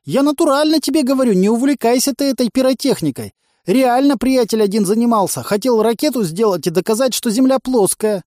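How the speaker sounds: high, loud, insistent character voice; agitated junkie underling. A narrator's reading. Dangling junkie warning the commander off pyrotechnics, agitated. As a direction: babble a warning, pitched well up.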